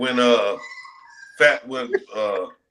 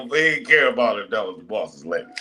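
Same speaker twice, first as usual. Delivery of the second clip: ordinary talk, nothing marked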